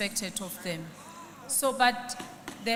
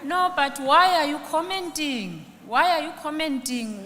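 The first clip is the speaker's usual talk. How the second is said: sharp, higher, louder scolding; exasperated rebuke; 5 semitones higher, 4 dB louder than usual. Real, not acted. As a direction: scold the heckler, fed up. Chair scolds heckling member.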